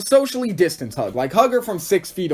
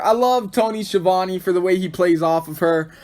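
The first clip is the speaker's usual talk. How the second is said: usual speaking voice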